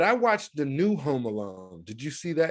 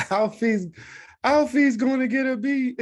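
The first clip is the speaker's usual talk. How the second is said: put-on child's voice; 9 semitones higher, 3 dB louder than usual. Quoting a favourite movie line.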